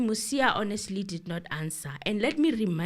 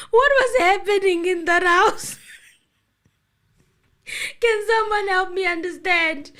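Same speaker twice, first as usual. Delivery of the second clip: laughing disbelief; 9 semitones higher, 9 dB louder than usual. Real, not acted.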